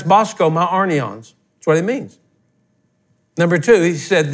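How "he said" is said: normal talking voice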